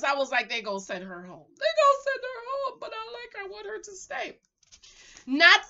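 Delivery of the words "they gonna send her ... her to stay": small, high put-on voice; high plaintive voice. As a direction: plead softly way up high. Repeats own thought in whiny voice.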